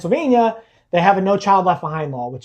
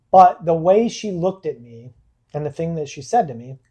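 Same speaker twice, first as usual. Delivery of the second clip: normal talking voice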